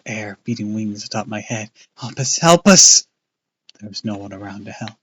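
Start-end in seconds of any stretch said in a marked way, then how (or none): 2.0-3.1 building to a loud high scream; desperate shout. A narrator's reading. Boy screams for help, building. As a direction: scream louder the second time.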